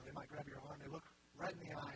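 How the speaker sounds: ordinary talk, nothing marked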